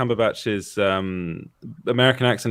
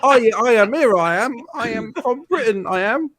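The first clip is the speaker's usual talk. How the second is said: high-pitched, hammed-up British accent; badly faked British accent, pitched way up; mock British accent; 10 semitones higher than usual. Mocking a film character's missing accent, exaggerated British voice. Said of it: "The Quest for the Missing Accent".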